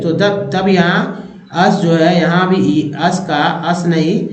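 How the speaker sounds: normal talking voice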